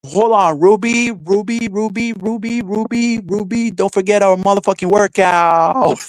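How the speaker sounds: high, sing-out insistent calling; Ava. Acted. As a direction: chant the name high, then stretch the last word. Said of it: "Imagine Ava would have".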